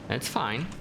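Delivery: ordinary talk, nothing marked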